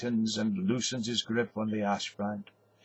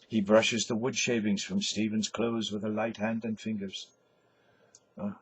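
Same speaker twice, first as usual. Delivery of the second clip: ordinary talk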